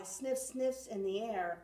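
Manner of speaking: plain reading voice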